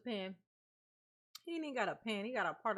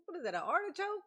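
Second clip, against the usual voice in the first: mock surprise; 8 semitones higher, 3 dB louder than usual. Doet alsof hij bekogeld wordt.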